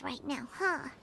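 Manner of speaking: hushed whisper, kept out of earshot; a secretive whisper so she won't hear; whispered aside. Acted. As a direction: lean in and whisper the gossip. Paimon's hushed aside about the Shogun.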